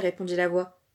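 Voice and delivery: usual speaking voice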